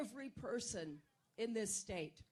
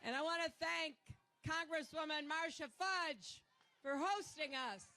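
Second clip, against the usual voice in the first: usual speaking voice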